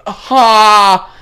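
mock pained cat yelp; cat cry. Cat straining, imitated.

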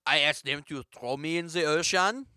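put-on French-accented mime. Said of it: "let me translate".

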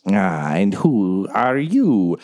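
slow, put-on challenge; unseen guardian. Unseen garden guardian questions the intruders, slow put-on voice. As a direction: draw out the question as a strange guardian.

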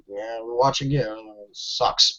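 low, soft mock resignation; resigned mumble-back, imitating players; unimpressed reply. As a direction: voice let-down players, dropped low and quiet.

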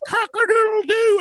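drawn-out mimicked cock-a-doodle-doo; parrot crowing. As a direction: crow it out slowly like a parrot. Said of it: "He vocalizes it?".

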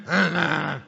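loud mock caveman grunts; imitating wrestler grunts. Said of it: "He grunts".

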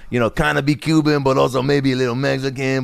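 cartoony Cuban-accented voice; exaggerated Cuban rapper accent. Shown as a demonstration. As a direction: put on a heightened Cuban, slightly Mexican accent. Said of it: "my impression was, like".

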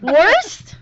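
very high, loud, incredulous echo; indignant disbelief. Real, not acted. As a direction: question it in loud outrage. Repeats insult, more indignant.

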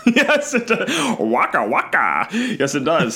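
high laughing Fozzie Bear voice; Waka Waka voice. Pretend. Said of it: "your genie impression sounds a lot like your Waka Waka".

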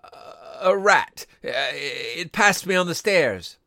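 falter, then force out a nervous excuse; frightened, halting and stuttering; shaking tones. A narrator's reading. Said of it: "said the old man in shaking tones".

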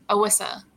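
"Alyssa" with the L turned into a W; childhood L slip. Shown as a demonstration. Actor recalling her sister's name as a child said it, "Alyssa" with W for L. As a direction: say "Alyssa" as "Awissa". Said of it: "hard time with L's and Y's".

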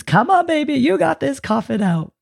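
put-on high cheerful baby-talk encouragement; fake baby voice. Shown as a demonstration. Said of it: "fake baby voice on and say positive phrases".

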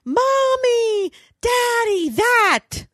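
high, drawn-out mock toddler wail; crying toddler. Mother imitating her toddler calling from his room, wailing. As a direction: imitate a little kid calling out in tears. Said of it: "he's crying and screaming".

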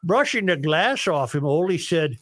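bad singsong Norwegian for the joke; worst Norwegian voice. Host telling Sven and Ole joke, worst Norwegian accent. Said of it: "use your worst Norwegian voice".

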